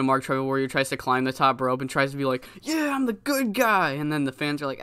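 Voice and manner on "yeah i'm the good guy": high-pitched mock boast; triumphant wrestler. Wrestler on the top rope to the fans, mock triumphant. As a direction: play the self-declared hero to the crowd. Acted wrestler posturing to crowd.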